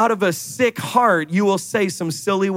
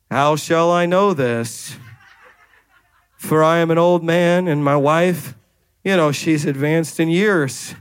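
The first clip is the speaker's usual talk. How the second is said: Zechariah; 4 semitones lower than usual. Acted. Old priest answering the angel about his wife, low and unimpressed. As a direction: answer low and deliberate, underwhelmed and weary. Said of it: "That's how I read this".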